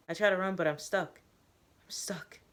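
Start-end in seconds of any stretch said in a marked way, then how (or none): 1.8-2.4 breathless whispered despair; a frightened hush. Acted. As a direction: whisper it to yourself, trapped. Trapped, panicking in a flashback.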